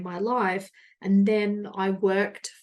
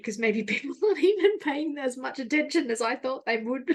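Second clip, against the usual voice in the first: a real chuckle runs through the words, pitched higher; laughing while talking; 5 semitones higher than usual. Real, not acted. Amused at herself, telling listeners with a real laugh. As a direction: giggle through the thought.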